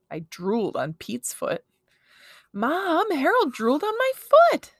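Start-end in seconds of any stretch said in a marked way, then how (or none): 2.5-4.7 put-on high, loud tattling kid; outraged child's high yell; whiny yell for Mom. A narrator's reading. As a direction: wail the complaint, climbing higher and louder. Boy yells complaint to mother.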